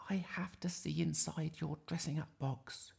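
put-on high, hushed child's whisper; little Iris. Iris waking Grandma early, high hushed plea. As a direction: whisper it urgently as a small girl. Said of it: "she said".

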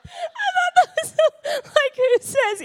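high laughing voice, words breaking up. Real, not acted. Laughing at her daughter's email line.

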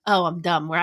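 usual speaking voice

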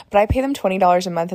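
normal talking voice

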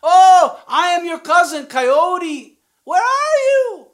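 high, loud eager call. Acted. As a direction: call out to find your cousin. Coyote calling back to fox.

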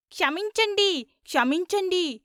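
high, insistent chant; chorused plea. A narrator's reading. Seven disciples begging Veerraju's pardon together, high chant. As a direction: cry it out high, like a group in unison. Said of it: "ఏడుగురు ఏకకంఠంతో".

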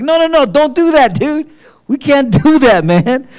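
high, loud mock-panicked protest; alarmed refusal. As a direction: act out a frantic, laughing refusal, pitch shooting up. Acting out panicked refusal of gift.

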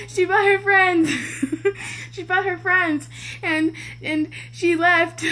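laugh-crying as you blurt it out; laughing through words. Real, not acted. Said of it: "at this point, I was crying".

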